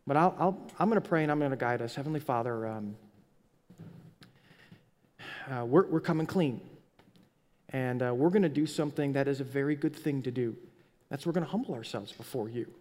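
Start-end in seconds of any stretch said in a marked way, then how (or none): 1.9-12.7 prayer. Real, not acted. Starts leading prayer.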